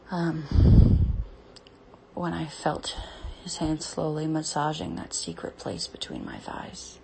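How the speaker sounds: ordinary talk, nothing marked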